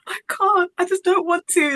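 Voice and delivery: nervous refusal. Retold or imitated. Refusing her friend's push to meet him, in a high nervous squeak. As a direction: back away in retold nerves, pleading. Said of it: "And it was that fear".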